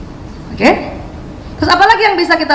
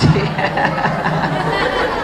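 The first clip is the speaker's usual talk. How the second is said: breathy laugh; 5 semitones lower, 6 dB quieter than usual. Real, not acted. Audience joke about the photo.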